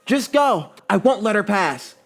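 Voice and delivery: urgent high-pitched yell; Sakura's high, forceful yell. A narrator's reading. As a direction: shout it out, high and loud. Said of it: "Sakura yells".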